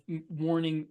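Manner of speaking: usual speaking voice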